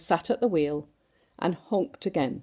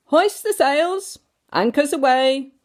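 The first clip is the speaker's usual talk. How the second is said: captain's orders; 8 semitones higher, 6 dB louder than usual. A narrator's reading. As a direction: bark orders like a jolly captain.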